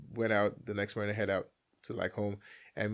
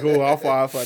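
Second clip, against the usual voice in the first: excited greeting; 4 semitones higher, 13 dB louder than usual. Retold or imitated. Brothers greeting, laughing.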